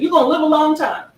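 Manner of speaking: high, quick quoted reply; imitated sister's saying. Sister answering her sibling's call, quick high imitation. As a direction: toss it back fast and high.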